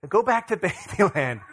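repeating the line through laughter; laughing. Retold or imitated. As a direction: laugh while quoting the child.

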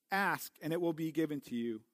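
usual speaking voice

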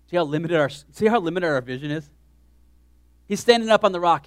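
ordinary talk, nothing marked

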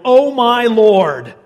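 loud, slow, drawn-out cry of alarm; alarmed yell. Acted. Servant sees the army coming, loud slow alarmed cry. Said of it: "He yelled".

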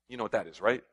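ordinary talk, nothing marked

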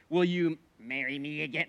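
a light hint of a Gollum voice. Old flesh tempting him, a light Gollum voice. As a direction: tempt like a creepy little creature. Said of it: "it's like a Gollum voice in my head".

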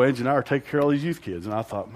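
normal talking voice

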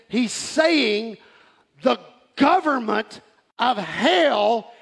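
slow, emphatic pulpit declaration; full-force preaching. Real, not acted. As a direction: proclaim it with drawn-out key words.

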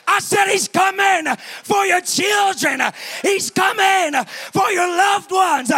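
high, loud, rhythmic shouting; roaring revival-preacher cadence; full-force preaching. Real, not acted.

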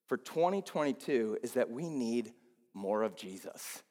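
ordinary talk

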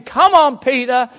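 high, loud, urging voice of Jesus; loud pleading encouragement. Acted. Jesus urging a fallen Peter, loud and pleading. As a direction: plead with him to keep going.

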